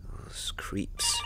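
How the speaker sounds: breathy whispered disgust. Real, not acted. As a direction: whisper it under your breath. Realises he was drugged.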